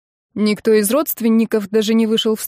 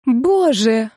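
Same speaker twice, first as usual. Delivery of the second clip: high, slowing wail of dismay; sudden horror; 7 semitones higher, 3 dB louder, slower than usual. A narrator's reading. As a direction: realise it with rising panic. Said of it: "вдруг дошло до неё". (с внезапным ужасом)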